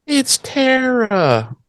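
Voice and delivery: mock-labouring, high drawn-out wail; imitating someone painfully slow to get words out; laboured slow speech. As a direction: stretch every word out in a high, struggling voice. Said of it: "it takes Willow three and a half hours".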